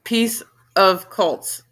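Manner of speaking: slow, word by word; deliberate, drawn-out reading; slow sounding-out. Real, not acted. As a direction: spell out the phrase slowly, puzzled. Repeats puzzling phrase slowly.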